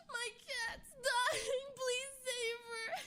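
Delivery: very high, slow sobbing plea; crying plea. Retold or imitated. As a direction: wail it out like a crying owner. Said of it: "tears streaming".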